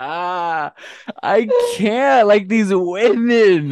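high, slow laughing wail; too amused to get the words out; helpless laughter. Real, not acted.

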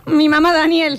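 high put-on child voice; a five-year-old's innocent question; little daughter. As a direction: play a puzzled little girl. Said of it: "Lola tiene cinco años. Es nuestra hija".

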